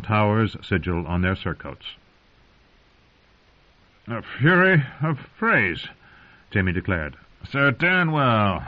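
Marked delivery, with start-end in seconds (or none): 4.1-7.2 wry knight. Acted. Jamie voiced mocking the Freys. 7.4-8.6 slow, raised naming of Danwell; mocking knight. Jamie voices the name.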